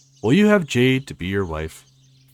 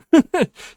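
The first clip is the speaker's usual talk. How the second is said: loud high laugh; 8 dB louder than usual. Real, not acted. Laughs at Fiona's name.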